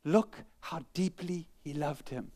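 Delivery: slow, softened awe; hushed, measured admiration; moved onlookers. Acted. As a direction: say it slowly and tenderly, as a moved bystander. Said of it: "The one respond".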